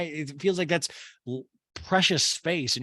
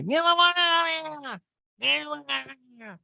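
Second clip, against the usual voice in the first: Erica impression; 12 semitones higher than usual. Erica throwing Jade out, high slurred mock shrieking. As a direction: shriek it in caricature, then fade. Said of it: "Erica's like".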